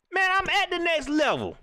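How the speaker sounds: high scoffing player voice. Retold or imitated.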